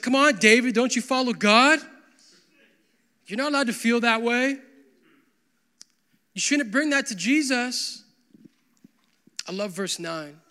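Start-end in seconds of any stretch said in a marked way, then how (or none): 0.0-8.0 loud, scolding rebuke; mock scolding churchgoer. Sarcastic churchgoer scolding David, louder. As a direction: wag a finger at David. Mocking judgmental church attitude.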